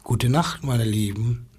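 tender bedtime farewell. Real, not acted. Storyteller's bedtime farewell to children.

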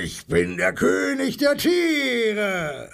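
high, drawn-out lion roar; brüllender Löwe. A narrator's reading. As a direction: roar it slowly like a proud king of beasts. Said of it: "schüttelt seine goldene Mähne und brüllt". (brüllend wie ein stolzer Löwe)

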